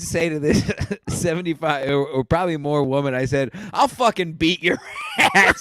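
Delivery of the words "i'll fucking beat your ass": laughing threat. Retold or imitated. Threatening the woman, retold.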